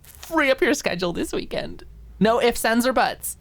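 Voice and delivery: grinning command. Acted. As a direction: beam and insist, no arguments. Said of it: "the smile grew wider and wider".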